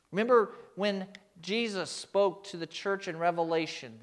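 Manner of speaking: ordinary talk, nothing marked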